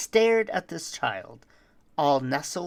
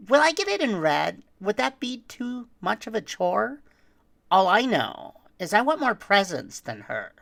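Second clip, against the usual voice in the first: put-on whiny greedy child; 5 semitones higher, 4 dB louder than usual. Greedy children voiced in poem.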